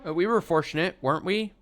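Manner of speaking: high, perky small talk; cheerful. Acted. Girl making an effort with grandma, cheerful. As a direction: chirp it to ease the tension. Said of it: "speaking to her in a cheerful voice".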